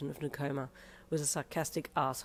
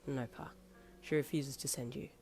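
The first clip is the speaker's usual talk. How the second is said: softer, lower comforting voice; soft, low reassuring; gentle reassurance; 4 semitones lower, 8 dB quieter than usual. Acted. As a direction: soothe the old man kindly. Boyfriend reassures tearful old man.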